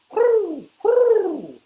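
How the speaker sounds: very high, loud hoots; owl hoot. Acted. Reader reviews the owl for kids, high hooting. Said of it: "He can sound like an owl".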